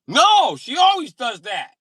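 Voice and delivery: high loud slowed complaint; indignant shout. Retold or imitated.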